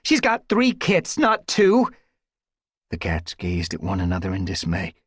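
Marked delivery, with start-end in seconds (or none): 0.0-1.9 high, slow panicked voice; rising panic for the kits. A narrator's reading. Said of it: "voice rose in panic".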